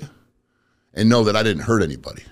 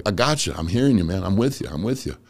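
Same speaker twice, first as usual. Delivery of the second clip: usual speaking voice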